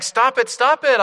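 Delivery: loud, high pleading cry; cried out. Acted. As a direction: beg him to stop. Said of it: "I cried".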